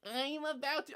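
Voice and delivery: high-pitched mock quote; mocking her younger self. Mimicking her seventeen-year-old self on video, mocking squeal. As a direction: squeal it back, imitating the recording.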